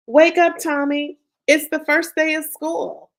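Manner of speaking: high, loud call to wake him; Mama yelling. Acted. Mom waking her son for school, loud call. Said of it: "Then I heard Mama yell".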